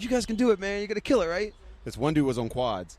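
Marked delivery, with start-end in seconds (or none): 0.0-1.5 high, pumped-up encouragement; rally them along; encouraging cheer. Retold or imitated. Cheering on the suffering skaters, high and pumped. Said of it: "I was like".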